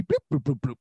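imitated video-game bloops; quick descending run of mouth-made bloops; drop sound effect. Shown as a demonstration. Host mimicking dropped balls, improvised video-game drop sound. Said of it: "the drop sound".

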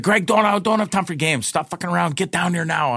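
high, rapid-fire imitation of an exasperated girlfriend; retold angry scolding. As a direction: cut him off with a mock telling-off. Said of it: "yelled at me again".

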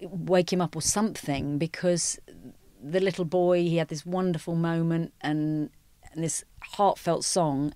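ordinary talk, nothing marked

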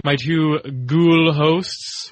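slow, hammy spooky drawl; spooky drawn-out voice. Pretend. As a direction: stretch ghoul out slowly. Dragged spooky emphasis on ghouls.

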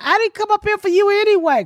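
put-on high, quick kid backtalk; sassy child. Child dismissing the woman upstairs, high and sassy. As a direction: mimic a little girl's high-pitched sass. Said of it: "She was like".

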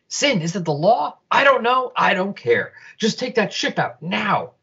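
high, rushed and agitated; frantic order. Acted. Guards under attack arguing about the law, frantic. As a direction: bark the order in a panic.

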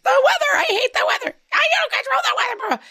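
put-on very high, fast whiny kid wail; high shrieking kid voice, hammed-up; mock kid tantrum. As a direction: squeal it like a furious child. Kid ranting about the weather.

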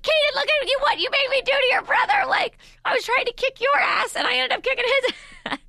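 very high, loud scolding screech; mock furious yelling. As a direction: re-enact a mother screaming at her son. Mother re-enacts yelling at older son.

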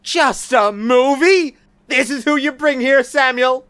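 loud, high incredulous anger; furious high-pitched confrontation; outraged. Acted. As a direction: throw it back as an indignant shout. Outraged quarrel with newcomer.

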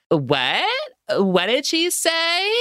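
high, mocking confused whine; exaggerated questioning, pitch shooting up at the end; Steph. Tourist baffled by Spanish, mock confusion. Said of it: "And fucking Steph says here".

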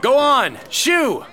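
high, loud shooing. Acted. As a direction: chase the frogs off. Shooing frogs away.

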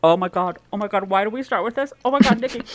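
gasping through a chili burn; mouth burning from spicy food. Real, not acted. Just ate a very hot chili.

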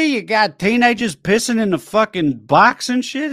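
high, loud Long Island rant; indignant Long Island accent; Long Islander. Acted. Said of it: "Long Islander discovering the existence of furries".

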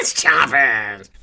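slow, drawn-out gruff host voice; a butch Crypt Keeper. Acted. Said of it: "a little butch for Crypt Keeper".

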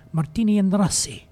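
lightly hammed-up Italian accent with a Russian tinge; playful, muddled Italian-Russian accent; Russian-infused Italian accent. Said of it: "Russian-infused Italian accent".

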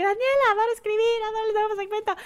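high, squeaky fan voice; fans' messages in a high squeal; gushing fan. Retold or imitated. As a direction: play an adoring fan writing in. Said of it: "me llegan muchos mensajes de que".

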